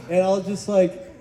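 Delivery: normal talking voice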